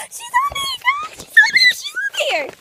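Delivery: very high, loud squeal; squealing to viewers that the dog made it; excited shriek. Real, not acted. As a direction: shriek with delight. Dog finally on trampoline.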